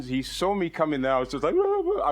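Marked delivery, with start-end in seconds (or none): 1.6-2.1 high-pitched imitated baby-like wail; hammed-up high sobbing noise; mock crying. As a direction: act out weeping like a baby, pitched way up. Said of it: "I was weeping like a baby".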